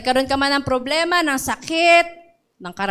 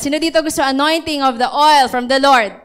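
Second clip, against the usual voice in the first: ordinary delivery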